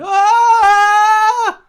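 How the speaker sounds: very high, loud sustained wail; imitated theme wail. Fan imitating Wonder Woman's entrance music, high loud wail. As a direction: belt out a piercing "ah" fanfare. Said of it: "it went".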